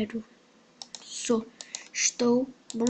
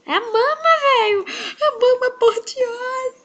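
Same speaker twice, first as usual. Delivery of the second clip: excited cry; 11 semitones higher, 4 dB louder than usual. Pretend. Playful announcing of himself.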